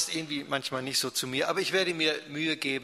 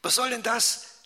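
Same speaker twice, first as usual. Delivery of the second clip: higher, louder indignant outburst; 9 semitones higher, 6 dB louder than usual. Acted. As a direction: snap back in outrage at the little brother. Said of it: "zischt empört". (empört, höher und lauter)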